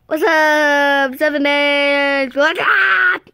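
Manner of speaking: hammed-up creepy greeting from the tape. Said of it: "a creepy voice says".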